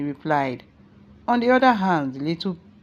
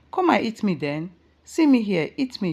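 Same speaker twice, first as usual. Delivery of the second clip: a higher, louder taunt building to "See me here"; 6 semitones higher, 4 dB louder than usual. A narrator's reading. Mother daring the hungry children to eat her, scornful. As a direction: dare them, scornfully.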